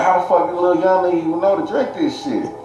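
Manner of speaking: lightly voiced astonished family; shocked family. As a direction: mimic relatives baffled. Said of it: "they tripping like".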